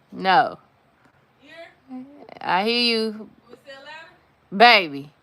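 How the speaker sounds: loud call to someone far off. Real, not acted. Woman calling out to her partner offscreen. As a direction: holler it across the house.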